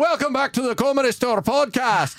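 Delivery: high, hammy Scottish host voice; Scottish accent. Pretend. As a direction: welcome them in a put-on Scottish accent. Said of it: "That's a Scottish, I think".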